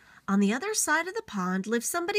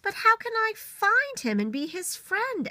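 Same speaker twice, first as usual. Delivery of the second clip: put-on higher, small childlike beaver; a young beaver asking the wise old beaver for help; little beaver voice; 12 semitones higher than usual. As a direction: ask it in a tiny creature voice. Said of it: "asked the little beaver".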